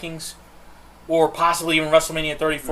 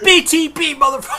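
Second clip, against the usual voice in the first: much higher, louder hammed-up yell; mock wrestling shout; 12 semitones higher, 6 dB louder than usual. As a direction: bellow the catchphrase for laughs. Joining the mock wrestling rant.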